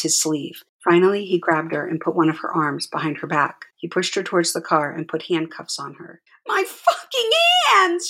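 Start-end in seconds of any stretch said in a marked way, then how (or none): 6.5-8.1 very high, slow anguished yell. A narrator's reading. Handcuffed mother yelling about her hands, very high yell. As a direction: howl it as the cuffs bite. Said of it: "she yelled".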